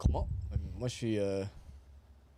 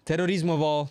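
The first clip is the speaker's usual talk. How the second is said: emphatic boast; 7 semitones higher, 8 dB louder than usual. Real, not acted. Boasting about his rival.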